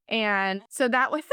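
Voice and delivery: ordinary delivery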